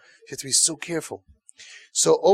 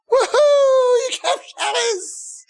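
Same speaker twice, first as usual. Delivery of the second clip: squealing high mock delight, much higher and louder; mock overjoyed cheer; 5 dB louder, much slower than usual. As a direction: cheer like a thrilled parent, hammed-up. Parent overjoyed at kept Shabbos.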